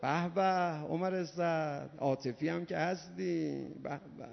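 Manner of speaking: soft, dropped sarcastic "bravo"; sarcastic praise. Real, not acted. Preacher mocking Omar Saad's tears, soft and sarcastic.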